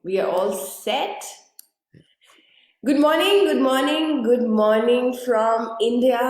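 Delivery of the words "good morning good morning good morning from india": loud, high, beaming hello to the world; excited greeting. Real, not acted. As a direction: call it out like opening a big show. Energetic live greeting to audience.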